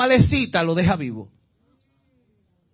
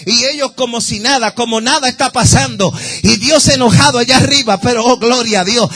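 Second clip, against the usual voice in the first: loud, high fervent preaching; full-force preaching; 4 semitones higher, 10 dB louder than usual. Real, not acted. Preacher stirring the congregation, fervent full-force preaching. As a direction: preach it with rising fire.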